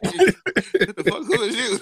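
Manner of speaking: loud, high shock through laughter; startled outburst. Retold or imitated. Man wakes to find a strange woman, startled shout. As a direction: jump back and yelp it, half laughing. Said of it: "Like, I just jumped".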